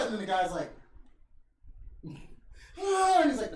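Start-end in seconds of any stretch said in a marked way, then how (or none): 2.8-3.2 high, loud straining grunt; hammed-up effortful huff; mock strain grunt. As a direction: push out a huge effort noise. Second wrestler straining.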